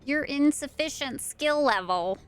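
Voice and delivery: ordinary talk, nothing marked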